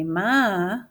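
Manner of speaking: dictating to the class one word at a time; teacher speaking slowly while writing on the board; slow word-by-word dictation. Shown as a demonstration. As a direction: spell out each word slowly as you write it. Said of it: "put two fingers down to indent and say".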